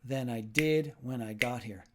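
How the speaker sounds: slow, stressed "did", sing-song rhythm; teacher leading choral rhythm practice; rhythmic stress. Shown as a demonstration. Teacher to learners, leading slow rhythmic repetition. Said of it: "say it with me".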